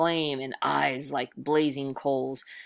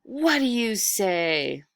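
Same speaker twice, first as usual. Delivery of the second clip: slow ghostly demand; 4 semitones higher, slower than usual. Acted. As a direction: press the boy for an answer. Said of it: "roared the figure".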